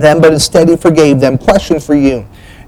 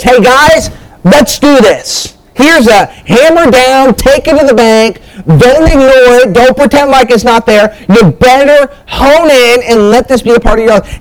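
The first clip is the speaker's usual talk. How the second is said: higher, slower, pressing each order home; command with emphasis; 7 semitones higher than usual. Acted. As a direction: preach it like a firm command. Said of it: "John's giving a command with emphasis".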